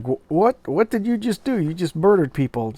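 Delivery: a hint of a scared woman confronting him; shocked. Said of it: "[name] is like".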